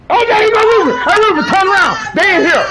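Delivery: high, loud excited shout to a teammate; urgent excited call across the game; excited callout. Real, not acted. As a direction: yell it out, thrilled he found them.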